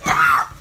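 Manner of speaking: breathy, loud mock scream; loud whispered scream. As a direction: shriek in alarm at the attempted kiss. Retelling a dodged kiss.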